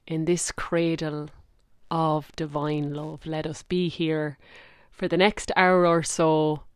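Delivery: guided meditation. Acted. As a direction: guide listeners gently down into the heart. Leads a heart meditation.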